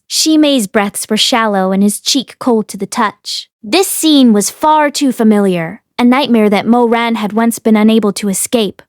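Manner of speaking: plain reading voice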